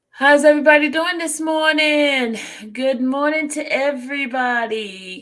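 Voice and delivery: loud, high hello to everyone; calling out greeting. Real, not acted. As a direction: call out a morning greeting to the crowd. Host calls out greeting to audience.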